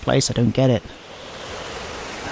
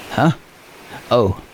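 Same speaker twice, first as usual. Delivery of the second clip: ordinary delivery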